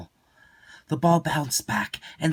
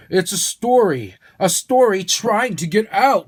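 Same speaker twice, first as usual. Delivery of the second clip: put-on old man storyteller; 8 semitones higher, 8 dB louder than usual. Zinger voiced, excited.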